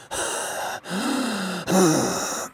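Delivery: long soft wheezing breath; soft breathy old man's wheeze. Acted. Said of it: "the language of wheeze".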